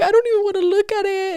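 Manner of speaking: high, whiny mock apology; imitating sad friend. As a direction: squeak out a guilty apology like the friend. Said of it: "She's like".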